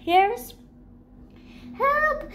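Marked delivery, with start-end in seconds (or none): 1.8-2.3 high, panicked cry for help. A narrator's reading. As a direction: shriek to get them off. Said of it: "Chunk cried".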